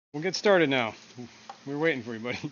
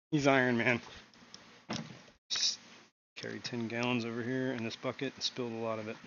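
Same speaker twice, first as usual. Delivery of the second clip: usual speaking voice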